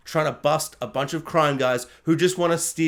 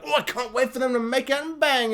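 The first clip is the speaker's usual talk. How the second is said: higher-pitched pretend eagerness; hammed-up longing, pitched up; mock swooning viewer; 7 semitones higher than usual. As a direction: imitate a gushing romance fan. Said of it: "you're like".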